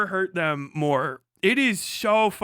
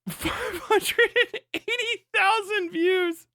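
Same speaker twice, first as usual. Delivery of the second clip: slow, high, laughing through the number; laughing disbelief; 12 semitones higher, slower than usual. Real, not acted. Reading out his view count, slow, high and laughing. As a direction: stretch it out, amazed and laughing.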